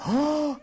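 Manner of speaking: mock gasp.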